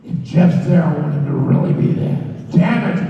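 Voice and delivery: loud, forceful demand; mock insistent Vince. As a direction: insist hard like an impatient boss. [name] voices Vince insisting.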